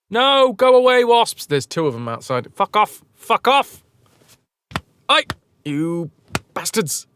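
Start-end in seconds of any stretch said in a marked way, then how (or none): 0.1-1.4 loud, higher shout to chase off the wasps; shooing shout. Real, not acted. Streamer yelling at wasps to go away, loud shooing. 2.5-7.1 swearing at wasps. Real, not acted.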